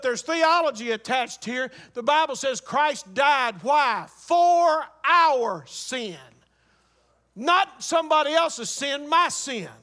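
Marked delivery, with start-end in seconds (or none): 4.1-6.2 "For our sin" drawn out very slowly and loud; weight on each word of "For our sin", stretched long; emphatic preaching. Real, not acted. Preacher answers his own question on why Christ died, "For our sin" slow and emphatic.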